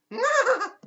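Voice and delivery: high, loud creepy squeal; short piercing mock cackle; imitated cackle. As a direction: mimic a giggling weirdo's sudden shriek. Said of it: "just cackling at me".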